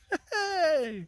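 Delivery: high, delighted laugh; a real laugh of relief and joy; joyful laugh. Real, not acted. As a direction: let the happiness spill out as laughter. Joy at understanding death.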